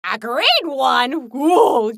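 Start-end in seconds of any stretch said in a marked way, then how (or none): none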